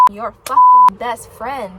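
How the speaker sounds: shouting in frustration. Real, not acted. She vents to the camera about being ignored, shouting. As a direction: shout it out, each word landing hard.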